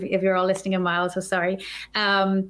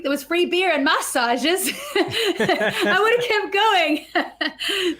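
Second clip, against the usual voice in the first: talking through laughter, higher; laughing; 8 semitones higher, much slower than usual. Real, not acted. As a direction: let the laugh break into the words. Joking about missed free beer.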